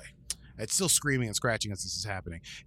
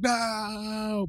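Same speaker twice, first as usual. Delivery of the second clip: mock cry of no; 10 semitones higher than usual. Uhura protesting behind door.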